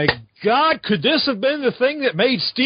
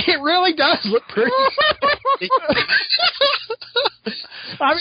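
high, giggly words through laughter; laughing; 14 semitones higher than usual. Real, not acted. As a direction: laugh while you talk. Giggling over a funny cover.

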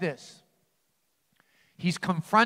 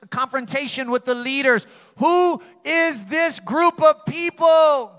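building preacher question; 5 semitones higher, 4 dB louder than usual. Acted.

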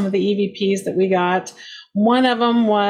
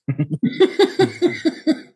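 laugh; 7 semitones higher than usual. Real, not acted. Laughs at own joke.

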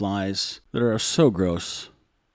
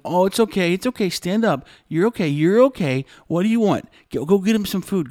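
high, loud, gushing mock comfort; mock fussing relatives; 9 semitones higher, 4 dB louder than usual. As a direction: coo over him like a fussing aunt. Relatives fussing over crying boy.

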